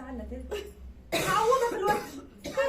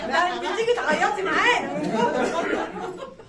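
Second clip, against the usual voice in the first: laughing invitation; 3 semitones higher, 9 dB louder, slower than usual. Retold or imitated.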